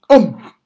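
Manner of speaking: loud, high gobbling gulp; a loud mock gulp of sandwiches; big gulp. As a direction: swallow it in one go. Tiger gulps all sandwiches.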